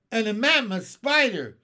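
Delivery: high, very loud exclamation; alarmed amazement. Acted. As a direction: shout the next horrifying discovery. Captain ignores officer, keeps exclaiming.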